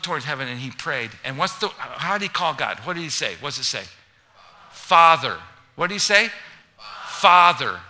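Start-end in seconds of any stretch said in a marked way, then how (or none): 4.7-5.4 loud call of Father. Real, not acted. Preacher prompting the crowd, calling out loud. Congregation calls out answer. 7.1-7.8 loud call of Father. Real, not acted. Preacher prompting the crowd, calling out loud. Congregation calls out answer.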